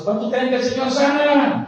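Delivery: rousing question thrown out to the crowd; re-enacted preaching. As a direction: imitate yourself preaching, pitch lifted. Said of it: "ahí estoy yo predicando".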